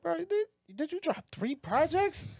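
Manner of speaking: high, drawn-out incredulous question; slow down and pitch it way up in disbelief; mock astonishment. Acted astonished reaction to releases.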